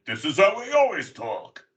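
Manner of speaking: put-on superhero voice, pushed and hammed up; badly faked deep hero voice; mock deep voice. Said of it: "he's got a deep voice".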